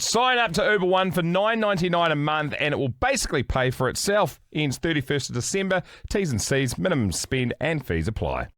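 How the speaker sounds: bright radio ad read. Acted. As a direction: sell it like a radio ad. Host reads an ad.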